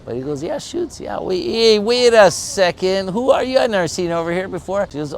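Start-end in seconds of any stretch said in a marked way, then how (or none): 0.4-1.0 pitched way up, caught off guard; a double-take mid-answer; startled yes. Acted. Beach surfer startled by the stranger, high and surprised. 1.0-4.9 high, loud, wary challenge; suspicious surprise. Acted. Beach surfer questioning the newcomer, high and wary.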